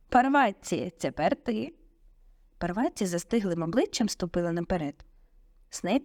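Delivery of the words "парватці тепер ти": teacher calling on the next pupil; raised voice, a brisk cue to a pupil. A narrator's reading. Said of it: "професор Люпин вигукнув".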